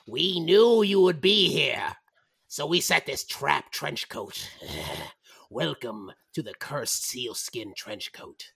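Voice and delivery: slow, gloating goblin voice; menacing goblin trio, starting loud and sinking; sinister goblins. Acted. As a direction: welcome victims into a trap, drawn out. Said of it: "The voice of three goblins in your, in your head is like".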